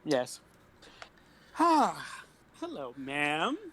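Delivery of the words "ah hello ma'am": put-on gentleman voice. Player greets old woman in character.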